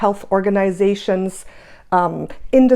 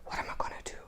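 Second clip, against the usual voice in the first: breathless, panicky whisper; retelling a moment of fear in a whisper; frightened whisper; 19 dB quieter, much faster than usual. Retold or imitated. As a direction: whisper it as if scared to say it aloud. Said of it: "I was like".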